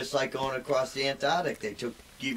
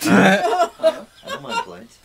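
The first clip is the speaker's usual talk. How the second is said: drawn-out squeaky laughing voice, much higher and louder; mock falsetto repeat; silly high voice; 9 dB louder, much slower than usual. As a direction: stretch it out in a goofy high voice.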